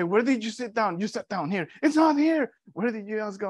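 high-pitched mock interrogation; frantic questioning sister. Sister searching the house with brother, frantic.

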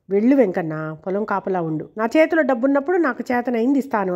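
ordinary delivery, nothing marked

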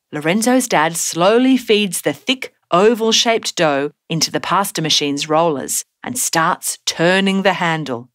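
ordinary delivery

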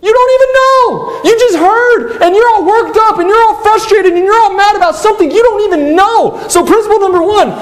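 preacher scolding the worked-up congregation; high, emphatic rebuke. Acted.

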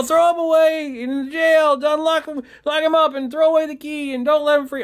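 mock the crowd demanding he be locked up; jeering crowd. Crowd calling for Paul's jailing, a high hammed-up yell. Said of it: "following him and saying".

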